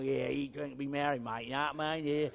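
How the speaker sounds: a half-hearted Aussie bloke voice; Aussie accent. Retold or imitated. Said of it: "It'll be that Aussie style".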